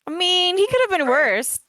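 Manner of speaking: high-pitched, defensive insistence; playful protest. Acted. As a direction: push it out high in mock defence. Playfully defending the crazy man.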